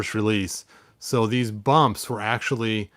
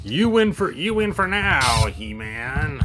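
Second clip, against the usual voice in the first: Skeletor voice; 2 semitones higher, slower than usual. Acted. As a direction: spit it out like a beaten villain who swears revenge. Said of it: "Skeletor said".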